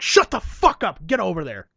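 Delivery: hammed-up shout to shut him up; mock angry yell. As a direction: snap it at him like a furious director. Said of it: "he's like".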